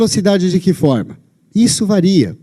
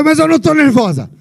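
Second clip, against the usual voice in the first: much higher, indignant snap; irritated retort; 10 semitones higher than usual. Retold or imitated. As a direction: bite back, insisting you're not upset. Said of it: "Aí ela fala". (retruca irritada, bem mais aguda)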